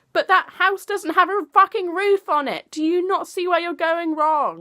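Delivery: loud exasperated outburst; mock outraged yell. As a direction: shout the punchline in disbelief. Ranting mock reply.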